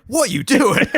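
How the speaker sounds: high, shrill disbelief; incredulous. Retold or imitated. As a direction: squeak it out in disbelief. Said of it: "And everyone's like".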